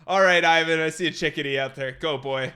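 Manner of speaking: calling the eagle out loudly like a falconer; loud handler command. Pretend. Handler sending Ivan the eagle after a chickadee, loud command. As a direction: bark orders to the bird, fast in the middle.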